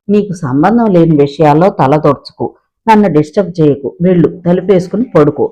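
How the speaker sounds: usual speaking voice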